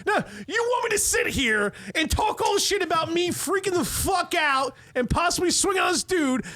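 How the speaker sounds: real indignant shout at his friend; heated shout. Real, not acted. Pushing back on a friend who calls the story dull, high indignant shout. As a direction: pitch way up, shouting in protest.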